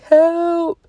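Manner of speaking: high, small pleading "Help"; imitating her crying son. Mother mimics her son stuck in his shirt, high tearful plea. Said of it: "That's exactly how he said it".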